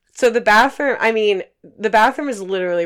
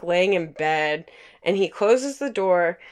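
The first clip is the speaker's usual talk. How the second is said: normal talking voice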